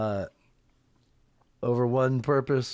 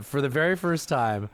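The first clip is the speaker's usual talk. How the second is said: normal talking voice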